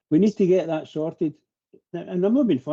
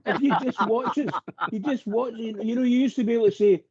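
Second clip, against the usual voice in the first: higher-pitched, heated fan rant; passionate outburst; 6 semitones higher than usual. Real, not acted. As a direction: let the frustration push the voice up. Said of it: "That's what you call passion".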